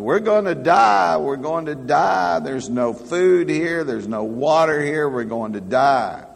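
high, loud panicked cries; shipwrecked man yelling in panic. Retold or imitated. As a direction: yell it like a castaway sure he'll die. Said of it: "one started yelling".

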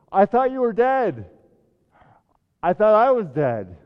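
loud, high, breathless astonishment; gasped joy. A narrator's reading. Sam gasping at Gandalf alive, loud astonished joy. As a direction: burst out as if seeing the dead alive. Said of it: "At last he gasped".

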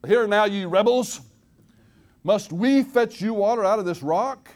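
high, loud, slow thundering rebuke; angry rebuke. Retold or imitated. Moses scolding the complaining Israelites, loud high slow shout. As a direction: bellow it down at the grumbling crowd. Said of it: "says these now immortal words".

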